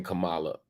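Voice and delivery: Kamala as kuh-MAH-luh, middle syllable stressed; mispronounced name. Retold or imitated. Host quotes Trump's way of saying it, Kamala mispronounced as kuh-MAH-luh. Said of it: "he keeps saying".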